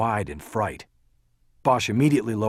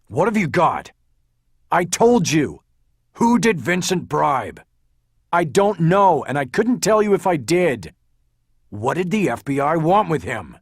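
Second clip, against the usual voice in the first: loud, high, forceful exchange; heated argument; 8 semitones higher, 7 dB louder than usual. A narrator's reading. Detective presses lawyer for answers, both heated. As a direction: fire it out, voice raised.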